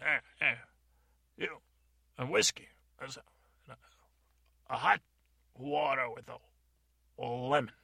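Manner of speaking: loud bar order; calling out. Acted. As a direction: hail the waitress across the room. GM voices a bar patron.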